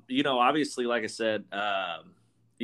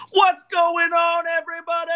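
hyped podcast intro; 6 dB louder than usual. Shown as a demonstration. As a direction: blast the greeting like a hype man. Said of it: "we just had to turn the intensity up".

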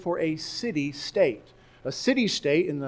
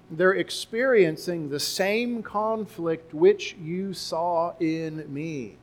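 slow reading; slower than usual. Acted. Reading verse thirty slowly.

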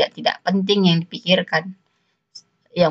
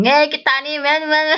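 mum talking slang; 8 semitones higher than usual. Retold or imitated. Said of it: "kadang ngomong".

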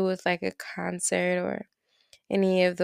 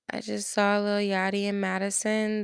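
normal talking voice

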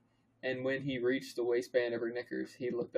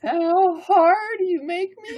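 very high, very loud, drawn out; slow squealed parody of seduction; absurd character voice; 15 dB louder, much slower than usual. Pretend. Reader teasing co-host, absurdly high slow voice.

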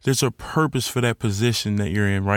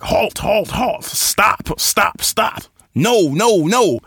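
warning voices; 8 semitones higher, 4 dB louder, much slower than usual. Retold or imitated. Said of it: "Guys like".

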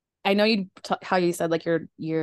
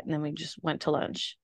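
ordinary talk

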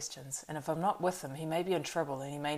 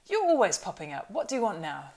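loud, raised voice of surprise; startled exclamation; 5 semitones higher, 7 dB louder than usual. A narrator's reading. Startled landlord confronting Strider, surprised outburst. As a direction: burst out at the unwelcome arrival. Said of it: "jumped with the surprise".

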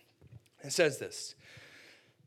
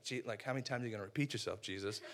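ticked off; 5 semitones lower, 8 dB quieter, faster than usual. Acted. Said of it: "Peter's kinda ticked off".

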